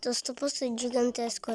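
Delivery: usual speaking voice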